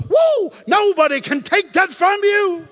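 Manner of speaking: high, loud, slow whoop; preaching shout. Real, not acted. Preacher to congregation, a loud high whoop. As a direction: lift it into a joyful shout.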